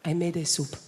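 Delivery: a hint of a doting Spanish mother; mild Spanish accent impression; Spanish mum accent. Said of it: "conversation always goes like this".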